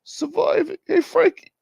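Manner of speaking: very high-pitched put-on voice; A light impression. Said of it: "I forgot how to do A light".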